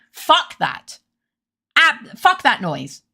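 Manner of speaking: slow, drawn-out scornful fuck that; fed-up dismissal. Real, not acted. Streamer dismissing fifty-pound coffee, scornful and slow. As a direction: spit it out with disdain, slowed down. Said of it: "I'm going on a rant about coffee".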